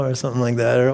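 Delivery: ordinary talk, nothing marked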